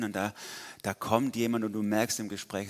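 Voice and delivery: ordinary delivery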